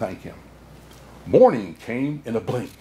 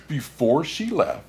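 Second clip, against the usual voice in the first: plain reading voice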